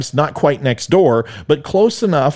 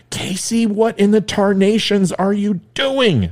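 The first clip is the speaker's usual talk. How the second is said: high, startled cry to stop her; mock alarm, pitched way up; alarmed outburst; 9 semitones higher than usual. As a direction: jump in with a panicky high shout. Boy cuts in, alarmed.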